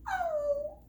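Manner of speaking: high startled oh; startled cry. A narrator's reading. Cub startled by the lizard's tongue, high yelp. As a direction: yelp at a smack on the nose. Lizard smacks his nose.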